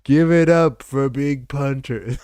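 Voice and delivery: loud, high announcer hype; a real, loud welcome for the guest; hype intro. Real, not acted. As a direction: bring the guest on like a ring announcer. Hyping the arriving guest.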